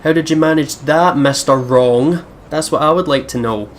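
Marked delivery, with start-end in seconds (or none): none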